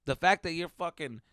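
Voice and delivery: ordinary delivery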